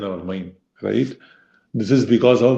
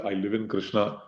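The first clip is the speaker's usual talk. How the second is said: much softer, settled reply; answering his own question as a calm devotee; soft devotee's answer; 3 semitones lower, 10 dB quieter than usual. Acted. A devotee answering the teacher, softly and surely.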